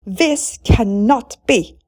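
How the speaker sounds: loud, slow outraged yell. A narrator's reading. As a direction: hammer each word in fury. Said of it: "yelled the stepmother".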